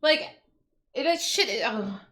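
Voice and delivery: ordinary delivery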